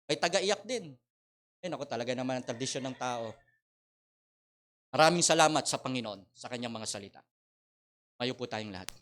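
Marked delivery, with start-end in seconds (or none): none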